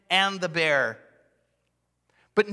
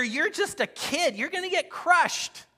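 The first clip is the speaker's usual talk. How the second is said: higher, taunting voice of a scornful soldier; scoffing doubter; 10 semitones higher than usual. Retold or imitated. Preacher voicing doubters who scorn young David, with a higher taunting sneer. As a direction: sneer down at the boy like a skeptic.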